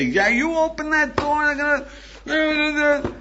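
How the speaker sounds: high, loud, angry mock yell at the door; frustrated yelling. To someone behind a locked door, a mock furious yell.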